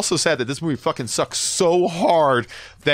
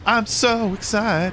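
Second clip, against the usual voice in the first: high mock excited voice; 8 semitones higher, much slower than usual. Mocking the excited fan.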